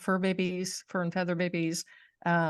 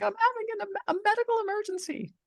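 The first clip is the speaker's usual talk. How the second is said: very high, fast panicked plea; hysterical; much faster than usual. Retold or imitated. Telling the cop she is going blind, hysterically high. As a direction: beg the cop in a frantic high voice. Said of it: "like, hysterical".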